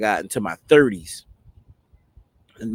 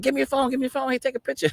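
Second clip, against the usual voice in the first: high, eager mimicry; happy onlookers imitated; much faster than usual. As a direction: ask excitedly, as the delighted staff did. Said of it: "they was all happy".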